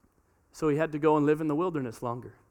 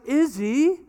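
parent scolding; 8 semitones higher, slower than usual. Retold or imitated. Parents scolding their daughter, raised exasperated voice. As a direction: demand an answer from Izzy. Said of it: "and it's always like".

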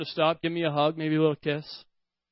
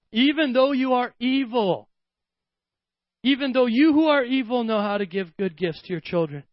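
preaching; 4 semitones higher than usual. Acted. Preacher reads Jesus' words with force.

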